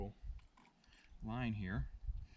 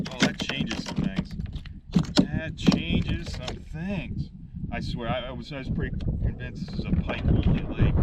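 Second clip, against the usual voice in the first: louder, slow and thrilled; excited; 9 dB louder, slower than usual. Real, not acted. Angler to camera about the first bass, excited and loud. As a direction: whoop it up over the first bass.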